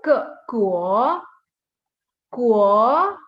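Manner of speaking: "kuo" loud, dipping then rising; model pronunciation. Shown as a demonstration. Teacher modelling the second syllable, loud rising "kuo". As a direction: start "kuo" on a hard k and swoop up. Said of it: "So we say".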